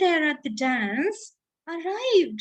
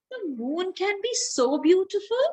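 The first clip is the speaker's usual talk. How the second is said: ordinary delivery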